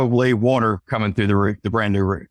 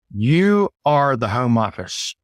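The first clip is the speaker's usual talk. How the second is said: normal talking voice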